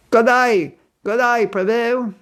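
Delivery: high Australian "g'day"; Australian accent. Shown as a demonstration. Demonstrating an Australian devotee's greeting, Australian accent. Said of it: "That Australian accent".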